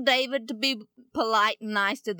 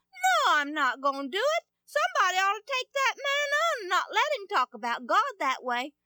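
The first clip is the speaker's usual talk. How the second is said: put-on stubborn young David; 11 semitones higher than usual. David answers his brothers.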